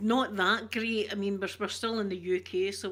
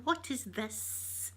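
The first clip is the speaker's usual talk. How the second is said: slow puzzled question; 3 semitones higher, 8 dB quieter, much slower than usual. Retold or imitated. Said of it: "they'd be going".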